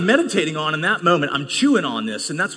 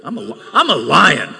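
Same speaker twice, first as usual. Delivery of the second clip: ordinary talk, nothing marked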